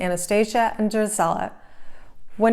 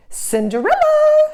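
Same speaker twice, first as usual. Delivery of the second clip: very high, loud, drawn-out shriek of a name; 6 dB louder, slower than usual. A narrator's reading. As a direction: stepmother screeching up the stairs. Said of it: "shrieked her stepmother".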